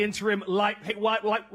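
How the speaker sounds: normal talking voice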